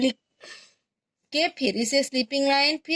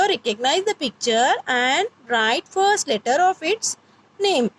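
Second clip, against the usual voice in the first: slow English; much slower than usual. Shown as a demonstration. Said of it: "teacher translating instruction".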